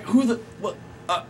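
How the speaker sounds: high, stammering mock confusion; imitating a flustered officer lost for words; stunned, speechless. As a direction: stumble over half-started questions in shock. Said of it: "he couldn't even talk".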